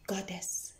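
breathy reverent whisper on one word. Acted. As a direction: let the last title fall to a whisper. Poem, whispered final title.

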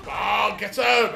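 slow loud shout. Real, not acted. Player cheering the kill, slow shout. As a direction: drag out the cheer. Urging in gunfight.

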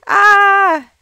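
high, loud cry of alarm; horrified player shrieking at her sims; panicked scream. Real, not acted. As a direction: scream as it all goes wrong. Fire reaches the family.

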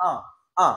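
"আ" with the mouth properly open; open-mouthed vowel. Shown as a demonstration. Teacher demonstrating the French number one, open-mouthed "আ". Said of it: "মুখ বন্ধ রেখে আমি আ বলতে পারবো না".

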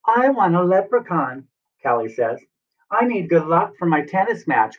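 high, pleading story character; eager Callie voice. Acted. Callie voiced, asking for leprechaun.

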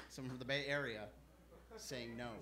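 low, soft repeat of the announcement; hushed repeat. Acted. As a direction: drop low and quiet before the act. Hushed repeat before the impression.

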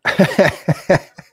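high burst of laughter; loud laugh. Real, not acted. Reacts to joke.

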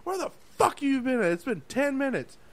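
high, loud furious demand; angry chef yelling. Retold or imitated. Angry chef yelling at the missing cook. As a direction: yell at the cook like a mad boss. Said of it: "The chef at the time was like".

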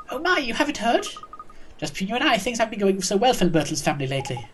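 put-on high-starting old lady voice, gossipy; old neighbour, confiding.

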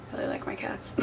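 unvoiced whisper. Real, not acted. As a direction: whisper it like a secret. Aside to camera.

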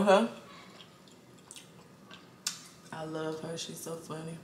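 talking between bites; chewing while talking. Real, not acted. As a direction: mouth partly full of food. Seen 3: Eats mussels from hand, lips closed chewing between talking.

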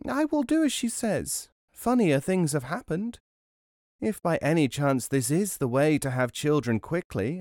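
high-pitched musing wife; wife musing to herself. A narrator's reading. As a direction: talk yourself into it in a high voice. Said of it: "said to herself".

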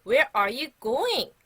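loud, slow, drawn-out question; big, slow teacher's voice; loud model phrase. Shown as a demonstration. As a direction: project each word for pupils to repeat. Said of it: "한 번 더 큰 소리로".

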